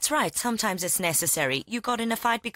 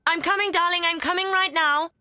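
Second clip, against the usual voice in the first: loud, high call to another room; 8 semitones higher, 4 dB louder than usual. Real, not acted. Aunt calling to her hungry nephew, loud and high. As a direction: call out brightly so he hears.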